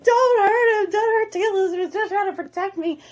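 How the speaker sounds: high, loud acted begging; frantic pleading. Hiccup begs dad to spare Toothless, frantic pleading. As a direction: cry out to protect a friend, hammed up.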